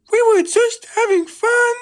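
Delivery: very high, loud whiny protest; sulky. A narrator's reading. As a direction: whine it like caught-out naughty cars. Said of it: "they said sulkily".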